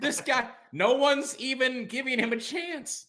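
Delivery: slow, half-joking outburst; mock outraged shout. Real, not acted. Podcast host shouts in mock defense of the galloping man.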